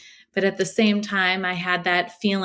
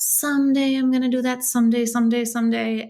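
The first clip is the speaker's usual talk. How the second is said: hopeful vow; 5 semitones higher than usual. Retold or imitated. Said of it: "something I would always whisper to myself".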